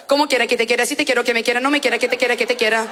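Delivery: low, even stream of babble; droning comic gabble; mock gibberish. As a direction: rattle off nonsense syllables without a break. Mimics babble to be noted down.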